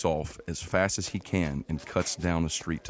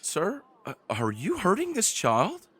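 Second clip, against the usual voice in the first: elven woman; 11 semitones higher than usual. Acted. Elven woman questions the wizard about the child, high voice. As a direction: voice a concerned female bystander. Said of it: "an elven woman that's standing in front of him says".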